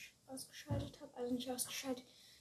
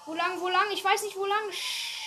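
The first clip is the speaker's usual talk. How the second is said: much higher, loud frantic asking; panicking gamer; 6 semitones higher, 8 dB louder than usual. Real, not acted. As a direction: panic with no idea where to go. Robber escaping.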